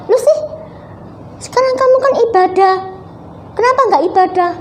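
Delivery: high, fast aunt's voice scolding; a high-pitched aunt telling the girl off. Retold or imitated. Aunt scolding Lucy for skipping worship, high and fast. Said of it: "Namanya tante aja ya".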